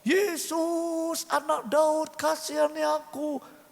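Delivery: high, drawn-out cry for mercy; acted pleading call. Blind Bartimius calling to Yesus for pity, acted high plea. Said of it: "Jangan teriak-teriak".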